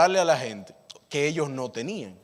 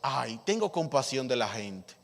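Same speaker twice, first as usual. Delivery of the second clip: tender compassion; 4 semitones lower, 6 dB quieter than usual. Acted. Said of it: "Y dice el Señor".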